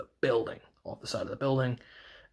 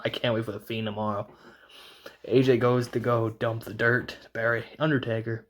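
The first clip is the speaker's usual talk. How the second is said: usual speaking voice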